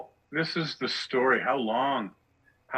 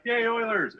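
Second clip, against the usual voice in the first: put-on much higher, gleeful little-kid cheer; excited young kid; 12 semitones higher than usual. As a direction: cheer like a six-year-old fan. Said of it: "somebody whose kid is, like, six or seven".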